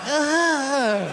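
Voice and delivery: high, slow, hammed-up whimper; whimpering scared kid. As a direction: wail like a terrified kid, drawn out. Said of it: "And the kid's like".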